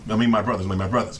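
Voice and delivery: ordinary talk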